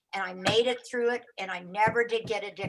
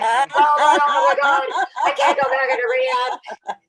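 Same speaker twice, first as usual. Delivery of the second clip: loud, drawn-out mock panic; horrified wail; 4 semitones higher, 10 dB louder, slower than usual. Patient hearing rehab mentioned, loud hammed-up wail. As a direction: howl it in comic horror. Retold panic at husband's words.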